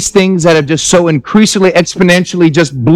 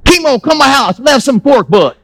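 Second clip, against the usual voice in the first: high, lightly Southern-drawled Pidgin; Southern Pidgin mix; 4 semitones higher than usual. Retold or imitated. Pastor imitating a Louisiana newcomer inviting Kimo over, drawled Pidgin. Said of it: "Southern fried pigeon".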